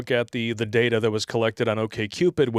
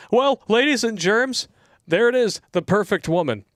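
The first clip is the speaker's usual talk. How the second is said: high, loud showman announcement; mock announcer; 9 semitones higher, 4 dB louder than usual. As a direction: present it like a game show host. Mock presenting the photo.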